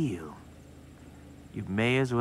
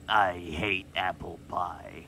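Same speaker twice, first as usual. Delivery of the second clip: low, slow tough-guy growl; practicing a deep tough guy; 7 semitones lower, slower than usual. Pretend. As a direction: drop it low and drag it out tough. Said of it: "Practicing my tough guy voice".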